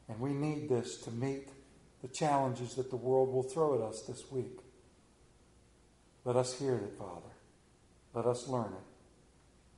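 hushed, reverent prayer voice. Acted. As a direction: pray softly and humbly. Leads a prayer.

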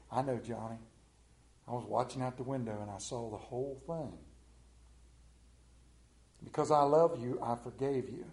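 put-on soft, gentle grandma reassuring the boy; grandma gently comforting crying grandson, tender and soft; warm grandmother. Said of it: "smile broke full and wide and warm".

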